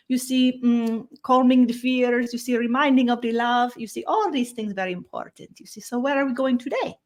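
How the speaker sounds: a gentle otherworldly visitor greeting the humans; [name] channeled voice. Acted. Channeled being answers host.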